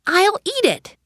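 put-on bright, eager little girl; enthusiastic. A narrator's reading. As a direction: say it with eager delight. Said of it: "said Alice enthusiastically".

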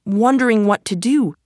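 plain reading voice

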